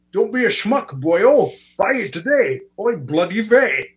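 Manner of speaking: Connery's mock Yiddish accent. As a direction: Sean Connery doing Yiddish.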